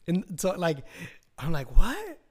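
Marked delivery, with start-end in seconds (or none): 1.8-2.2 loud high startled what; a sharp rising shout of disbelief; surprised. Retold or imitated. Hearing the neighbor lives close, loud startled what. Retold shocked reaction.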